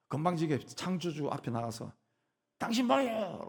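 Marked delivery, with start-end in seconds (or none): 2.6-3.5 high-pitched acted challenge; confrontational. Creature brazenly challenging the Creator, confrontational. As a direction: confront them with an indignant, raised-pitch demand. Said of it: "라고 막 따지는 거예요".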